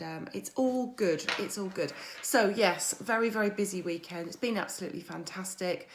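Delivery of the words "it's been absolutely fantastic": normal talking voice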